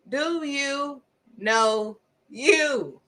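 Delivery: each word drawn out with pauses; slow emphatic question. Real, not acted. Preacher pressing listeners to know themselves, slow and emphatic. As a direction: spell out DO, YOU, KNOW, YOU slowly, word by word.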